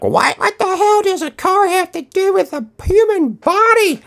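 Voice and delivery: high, squeaky mock objection; cartoonish disbelieving question; mock whiny listener. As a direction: play the baffled skeptic in a silly high voice. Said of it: "And, and you're like".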